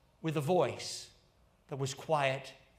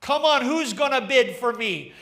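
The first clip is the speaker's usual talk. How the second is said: higher, louder auctioneer's rising bids; auctioneer crying out; 4 semitones higher, 8 dB louder than usual. Acted. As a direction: rattle off the bids to the crowd. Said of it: "And then he cried out".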